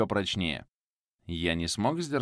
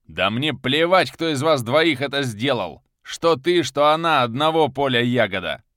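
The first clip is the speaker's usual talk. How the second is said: high, loud raging yell; furious shout; 5 semitones higher, 7 dB louder than usual. Acted. Viktor rages at Makhan and his daughter, shouting. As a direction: scream him down.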